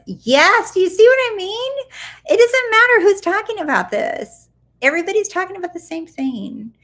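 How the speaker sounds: high, loud burst of excitement; excited. Real, not acted. Answering a viewer, high and loud with excitement. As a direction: jump up with enthusiasm.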